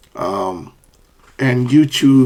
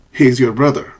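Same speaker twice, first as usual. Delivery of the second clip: ordinary talk, nothing marked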